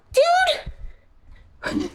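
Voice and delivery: high startled yelp of "Dude!"; startled cry. Real, not acted. Yelping at the pet in the box, startled.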